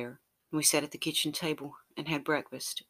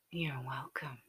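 whispered; 10 dB quieter, slower than usual. Acted. Lover replies close and intimate.

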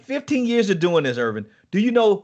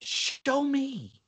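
softer, pleading; soft plea; 9 semitones higher, 6 dB quieter than usual. Retold or imitated. David asking God, soft plea. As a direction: ask it softly. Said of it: "David asked God".

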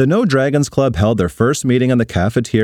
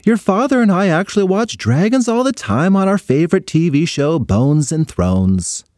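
mom voice; 7 semitones higher than usual. Acted. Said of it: "said her mom".

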